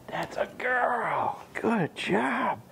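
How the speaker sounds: very high, slow, gushing praise; drawn-out sweet dog praise. Real, not acted. Praise on delivery.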